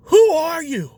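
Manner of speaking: put-on high, loud panicked drunk questions; hammed-up frantic drunk, high and loud; freaking out. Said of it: "He's, like, freaking out".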